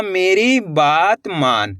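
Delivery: slow, each word separated by a pause; slow word-by-word reading. Shown as a demonstration. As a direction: read it out word by word for learners. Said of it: "वाक्य का उच्चारण कैसे हो रहा". (धीरे-धीरे, शब्द-शब्द अलग)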